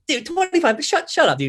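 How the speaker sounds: high scoffing brush-off, retold; mocking disbelief. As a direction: laugh off a silly lowball price. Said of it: "laughs in my face".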